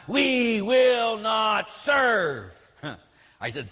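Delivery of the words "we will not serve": high, drawn-out protest chant; mock screaming nun. Retelling the nun's speech to the parish, mock screaming. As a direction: imitate a rallying cry, slow and stretched. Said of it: "she screamed".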